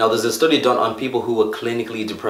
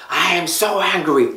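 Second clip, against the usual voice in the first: high, slow mock upset "I am livid"; hammed-up angry declaration; acting upset; 8 semitones higher, slower than usual. Shown as a demonstration. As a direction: act out a charged complaint, drawn out. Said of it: "we use certain words like".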